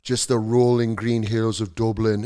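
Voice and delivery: slow, low Irish lilt; mock Irish accent. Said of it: "Irish people would be like".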